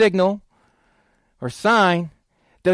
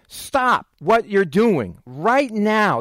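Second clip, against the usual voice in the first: very slow, higher "Stop what you're doing right now"; emphatic command; 4 semitones higher, much slower than usual. Acted. Jesus ordering the worshipper to "Stop", emphatic command.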